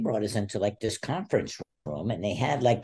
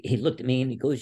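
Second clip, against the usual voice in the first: usual speaking voice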